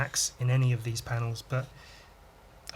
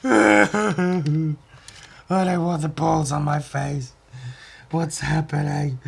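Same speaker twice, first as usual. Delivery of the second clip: a cartoon guy protesting, louder; voicing cartoon character; 3 semitones higher, 5 dB louder, slower than usual. Drawn character, balls on face, put-on protest.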